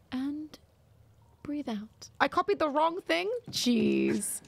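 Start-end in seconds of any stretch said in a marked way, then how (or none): 0.1-2.1 soft fading breath guidance; soothing voice. Shown as a demonstration. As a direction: let the words fade out softly. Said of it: "Soothing voice".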